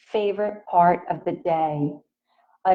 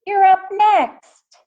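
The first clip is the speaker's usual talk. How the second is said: high, louder, slow call; 11 semitones higher, 5 dB louder, slower than usual. Acted. Emilio calling Justin to the tetherball court, raised call. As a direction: sing it out across the yard. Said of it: "Emilio calls from the tetherball court".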